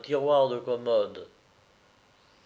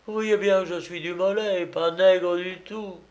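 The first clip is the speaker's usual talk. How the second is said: raised, insistent voice of vindication; protesting Dumolay; 6 semitones higher, 3 dB louder than usual. Acted. Dumolay half washed proving his identity, insistent. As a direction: drive the proof home.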